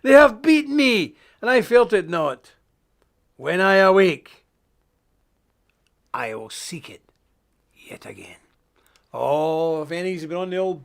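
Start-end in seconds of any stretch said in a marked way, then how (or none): 0.0-4.5 high, loud, slow proclaiming of the verse; scripture declamation. Acted. Preacher reading scripture to his flock, loud and declaiming. 6.1-8.4 soft whisper of a drinker's secret craving. Acted. Preacher ending the verse to his flock, whispering.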